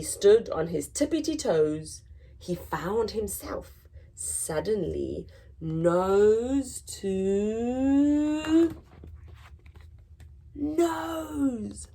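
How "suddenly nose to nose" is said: very slow, drawn-out build to nose; suspenseful slowdown. A narrator's reading. Narrator slows right down as Mouse meets Lion, drawn out. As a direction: stretch it out for suspense.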